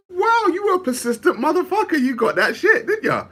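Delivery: high, laughing sarcastic praise; mock impressed. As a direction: tease them, voice way up and giggly. Mock reply to persistent suitor.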